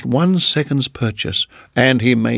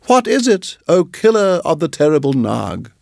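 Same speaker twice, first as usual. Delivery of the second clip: much higher, flattering chirp; little bird praising the mongoose, much higher; high fawning bird; 4 semitones higher than usual. Acted. Darzee voice.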